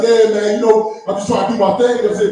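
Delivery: put-on southern accent. Dude just doing his thing, mock southern accent. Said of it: "the more southern our accent gets".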